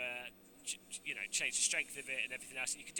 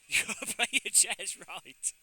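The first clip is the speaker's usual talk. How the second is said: chuckling through the line; laughing; 4 semitones higher, 3 dB louder, slower than usual. Real, not acted. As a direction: laugh while saying it. Laughing punchline.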